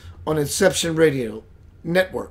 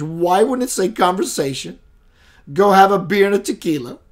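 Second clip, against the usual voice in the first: usual speaking voice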